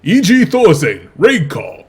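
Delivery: loud, high-pitched announcer shout; Korean Street Fighter announcer. Retold or imitated. Caster showing co-caster how the GSL guy says it, loud Korean announcer voice. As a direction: boom it out like a fighting-game announcer, ending on "Raid Call". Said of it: "like the, uh, the Korean Street Fighter voice announcer".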